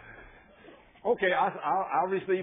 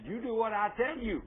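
higher-pitched retold order; firm command; 5 semitones higher than usual. God to a reluctant preacher, imitated firm command.

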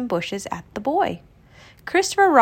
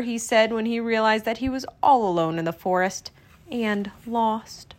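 ordinary delivery

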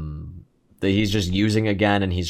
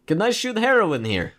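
higher-pitched mock Russian accent; over-the-top Russian accent; 9 semitones higher than usual. Junkie asks to shoot heroin here, over-the-top Russian accent. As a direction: imitate a comical Russian junkie. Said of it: "really over-the-top Russian accent".

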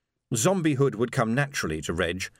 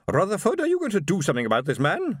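high-pitched, haughty call on a husband; imperious wife; 8 semitones higher than usual. A narrator's reading. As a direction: order your husband to deal with it. Imperious wife orders her husband about.

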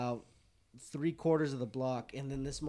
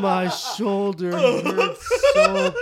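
put-on drunk groan; 7 semitones higher, 15 dB louder, much slower than usual. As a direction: whine about the shoulder like a drunk. Drunk friend pushed onto concrete.

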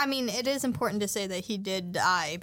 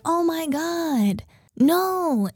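higher, louder, drawn-out mock horror; mock dismay; 5 semitones higher, 5 dB louder, much slower than usual. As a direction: play exaggerated shock, stretched out. Acting the crowd's shocked grief.

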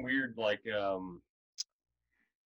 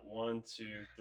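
counting under breath; 10 dB quieter, slower than usual. Real, not acted. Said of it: "let me count".

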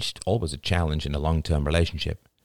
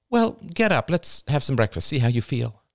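higher, upbeat mom voice; coaxing mother; 7 semitones higher than usual. A narrator's reading. Mother getting her son out of bed, upbeat. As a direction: nudge him along brightly.

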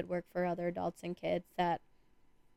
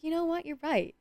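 mock realization; 9 semitones higher than usual. Her retold reply, pitched up.

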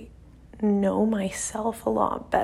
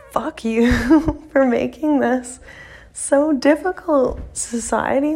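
louder, exasperated jab with a laugh; half-joking outburst; 3 semitones higher, 5 dB louder, slower than usual. Real, not acted. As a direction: vent at society, half laughing. Venting at society, half laughing.

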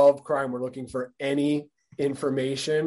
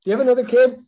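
louder, higher, fast mock surprise; 11 semitones higher, 8 dB louder, faster than usual. Teasing a co-host about a babysitter excuse, mock surprise. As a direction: blurt it out as if shocked by the news.

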